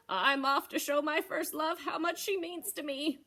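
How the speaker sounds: lightly voiced eager goat; cheerful billy goat, lightly played; Mister Goat voice. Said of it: "he told his cat Beatrice".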